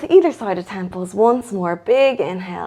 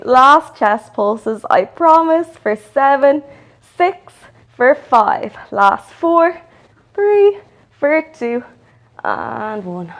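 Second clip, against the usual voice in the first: strained, higher, louder counting mid-crunch; doing sit-ups; 5 semitones higher, 4 dB louder, slower than usual. Real, not acted. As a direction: count the reps out while crunching hard. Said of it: "my abs are on fire".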